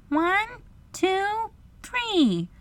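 very high, measured count; little girl counting. A narrator's reading. Wendy starts the race, high girlish count. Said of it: "Wendy gave the words".

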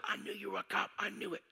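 high, hushed imitation of panic; freaking out. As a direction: mimic a panicking kid without the volume. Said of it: "The kid's freaking out".

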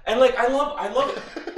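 high, loud laughing words. Real, not acted. Laughing mid-sentence.

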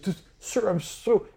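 high, pleading apology; begging servant. Acted. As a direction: whimper it out, cowering. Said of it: "He's like".

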